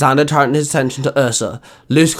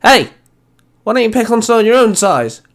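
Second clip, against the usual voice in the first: sharp, high, loud challenge; barked; 9 semitones higher, 4 dB louder than usual. Acted. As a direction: bark at the bully to back off. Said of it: "a voice barked".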